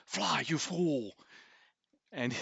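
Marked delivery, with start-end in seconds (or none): 0.1-1.1 higher, drawn-out acted call; heroic character's cry. Talon to Puck while running past, acted drawn-out cry. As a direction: call it out slowly in character, pitched up. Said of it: "he looks at Puck and he goes".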